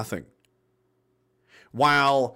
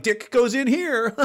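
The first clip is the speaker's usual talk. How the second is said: higher, slow, laughing mock caption; mocking through laughter; 7 semitones higher, slower than usual. Mocking the open-mouth photo, laughing and slow. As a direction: laugh while mimicking the photo pose.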